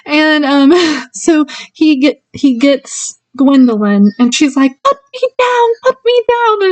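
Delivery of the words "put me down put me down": high, panicky mock protest; freaking out. Girl being hauled away screams to be let go, high mock panic. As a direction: squeal it like a girl being carried off. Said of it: "and she's freaking out".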